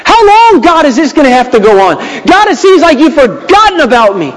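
high, pleading cry to God; anguished lament. Acted. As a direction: plead like David in despair. Paraphrasing David's complaint.

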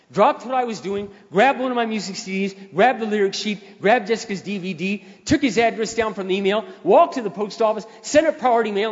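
excited fast list. Acted. Energetic retelling.

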